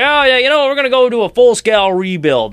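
high, loud smug mock voice; mocking the front office. Mocking the front office announcing a rebuild, smug and loud. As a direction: parody a breezy executive. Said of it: "It's like".

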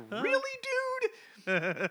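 very high, drawn-out disbelief; incredulous. Retold or imitated. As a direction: squeal it slowly in amused disbelief. Own incredulous reaction quoted.

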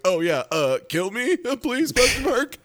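high laughing fake agony; hammed-up dying man, laughing through it; mock pained plea. [name] remembers he's in pain.

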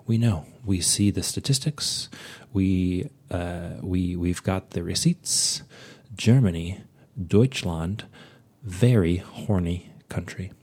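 normal talking voice

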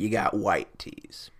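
slow conspiratorial whisper. Acted. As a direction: whisper it like a secret. Whispering a fancy tea option.

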